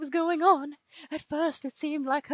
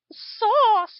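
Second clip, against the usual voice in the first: jovial; 5 semitones higher, 12 dB louder than usual. A narrator's reading. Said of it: "Hitler said jovially".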